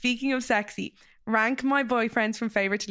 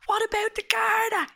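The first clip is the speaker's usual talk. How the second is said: mock audience shout; 13 semitones higher than usual. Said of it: "some girl from the audience shouts up and goes".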